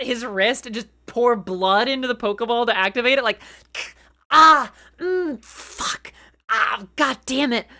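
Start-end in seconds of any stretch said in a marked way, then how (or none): 4.3-7.7 pained grunts, high and loud; mock pained Ash. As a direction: act out cutting your wrist. Acting Ash in pain.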